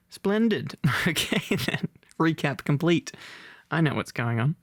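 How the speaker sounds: ordinary delivery, nothing marked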